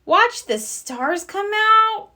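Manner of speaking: slow, high whiny complaint; complaining. A narrator's reading. Sister complains to Mama about stargazing, slow and whiny. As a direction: drag it out in disgust. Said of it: "complained Sister".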